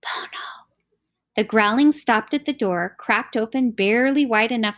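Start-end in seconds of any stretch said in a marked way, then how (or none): 0.0-0.7 hushed whisper, close and soft. A narrator's reading. Char Siu leaning close to the door jamb, whispering. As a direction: whisper it secretly through the crack. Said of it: "leaned close to the door jamb and whispered".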